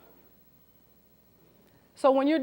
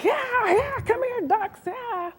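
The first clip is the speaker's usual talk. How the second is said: crude duck caller; 13 semitones higher, slower than usual. Retold or imitated. Early hunter hollering at ducks, high-pitched eager call. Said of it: "started going".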